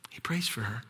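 softly breathed whisper; hushed whisper of prayer. Shown as a demonstration. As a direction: whisper it, reverent.

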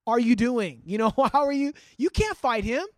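loud, shocked disbelief; incredulous king. Retold or imitated. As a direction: stop him cold, stunned he'd even try. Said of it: "He's like".

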